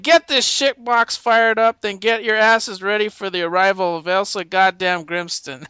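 rant, then order the troops into action; hammed-up vulgar commander; cussing general. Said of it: "he cusses like nobody's business".

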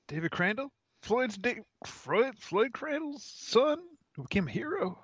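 put-on shaky, high old security guard; old man voice. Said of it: "I like how you try to do an old man voice".

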